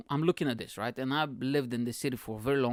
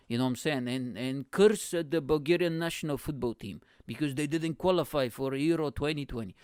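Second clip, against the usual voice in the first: ordinary talk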